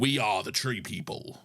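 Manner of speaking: slow, stretched R, eerie low tone; deep alien voice. Shown as a demonstration. Cohost retakes the alien line, slow and eerie. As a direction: draw out the R like a spooky creature. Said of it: "elongate the R".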